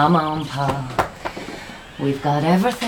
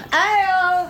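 calling out; 10 semitones higher than usual. Acted. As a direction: shout his name to find him. Mother calling for her son.

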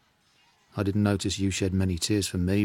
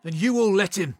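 high, louder bitter outburst; aggrieved outburst; 9 semitones higher, 4 dB louder than usual. Acted. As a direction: let the hurt burst out. Achilles rants about his grievance.

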